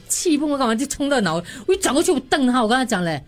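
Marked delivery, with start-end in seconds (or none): none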